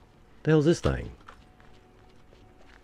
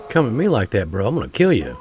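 loud, heated threats at the creatures; real anger, shout it right at them; furious gamer shouting at attackers; angry yelling; 3 semitones higher, 5 dB louder than usual. Real, not acted.

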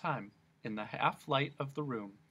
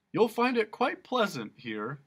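chuckling; 10 semitones higher, 6 dB louder than usual. Acted. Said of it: "chuckled the policeman".

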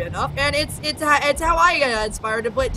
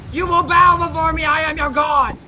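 booming god voice, louder; dark lord; 3 semitones higher, 3 dB louder than usual. Acted. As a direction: command them to bow. Commanding creation as god.

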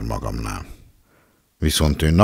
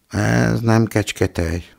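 grumbling; 9 semitones higher, slower than usual. A narrator's reading.

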